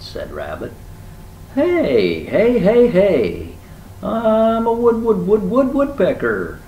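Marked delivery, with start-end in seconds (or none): 1.5-6.6 high, loud bird character; manic, high-pitched stuttering shout; shouting woodpecker. A narrator's reading. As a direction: yell down from the treetop, pecking out repeats. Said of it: "Woodpecker shouted down at him".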